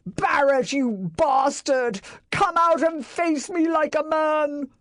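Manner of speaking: high, loud furious bellow; bellowing rage. A narrator's reading. Enraged master bellowing at his neighbour's door. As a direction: roar at the open door. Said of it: "bellowed his rage".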